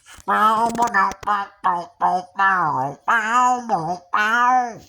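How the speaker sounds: loud, high mouth-made guitar riff. Shown as a demonstration. Proving he can do [name]'s tricks, loud vocal guitar imitation. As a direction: wail the riff like an electric guitar. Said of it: "Purple Haze".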